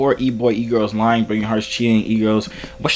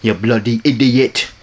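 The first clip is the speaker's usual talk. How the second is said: slow, louder mock British insult; angry British; 4 dB louder than usual. Shown as a demonstration. Prankster demos his angry British voice to the viewer. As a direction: spit it out like an angry Brit. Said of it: "I'm only good at being angry British, like the".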